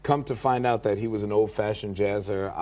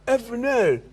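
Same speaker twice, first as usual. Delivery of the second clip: put-on drunk [name] shout; 11 semitones higher, slower than usual. [name] spotting [name] in a club, drunk high shout. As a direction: bellow it out, well oiled. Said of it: "He went".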